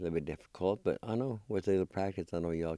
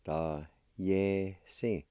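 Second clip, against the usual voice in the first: separated syllables at a measured pace; careful teaching demo, syllables apart; much slower than usual. Shown as a demonstration. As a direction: say "Ta, ye, si" with short gaps. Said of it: "It's pronounced like this".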